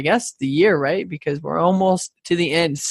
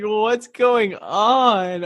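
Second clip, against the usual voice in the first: slow, drawn-out mock bewilderment; confused child; 3 semitones higher, much slower than usual. Host voicing the confused kid, slow mock bewilderment. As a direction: drag it out like a baffled kid. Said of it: "The child was so confused".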